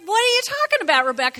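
hammed-up baffled challenge to the hairdresser; incredulous retort. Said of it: "And I'm like".

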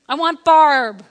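loud, emphatic demand; retold exasperated shout; insistent demand. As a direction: demand the midwife at full volume. Wife insisting on midwife.

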